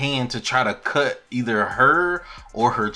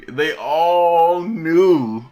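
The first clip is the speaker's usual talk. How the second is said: very slow, loud, higher-pitched stretch; drawn-out emphasis; 5 semitones higher, 8 dB louder, much slower than usual. Real, not acted. Storyteller revealing the whole room's guilt, drawn out emphatically. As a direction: draw every word out for dramatic weight. Dramatic climax of the recap.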